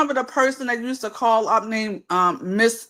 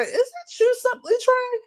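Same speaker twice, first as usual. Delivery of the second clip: lilting, much higher quoted question; retold probing question; 12 semitones higher than usual. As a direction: raise the voice high as if quizzing a caller. Retelling own question to caller.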